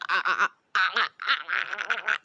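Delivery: laughing choked ah sounds; mock choking gasps. Swing being choked, imitated with laughing gasps. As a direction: gasp like someone being choked. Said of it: "Swing's like".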